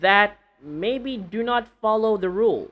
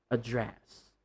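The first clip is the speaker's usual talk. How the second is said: adDRESS, soft and low, weight on DRESS; stress on second syllable; 6 semitones lower, 9 dB quieter than usual. Shown as a demonstration. Teacher gives the verb form, second-syllable stress. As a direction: Address with a weak first vowel. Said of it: "the address verb means".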